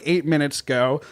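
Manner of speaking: ordinary talk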